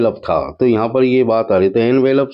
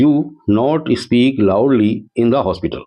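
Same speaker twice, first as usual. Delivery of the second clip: slow dictation read; much slower than usual. Shown as a demonstration. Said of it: "reading the question sentence".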